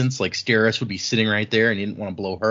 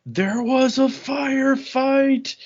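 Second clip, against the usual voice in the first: much higher, slow drawn-out shout; hammed-up film-line delivery; movie quote impression; much slower than usual. Quoting the Boondock Saints line, much higher and slow. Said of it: "a quote as from that guy".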